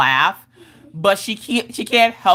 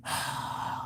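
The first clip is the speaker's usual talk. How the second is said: long breathy exhale; imitated sigh; 16 dB quieter than usual. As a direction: let out a kid's put-upon sigh. Kid sighs before reading letters.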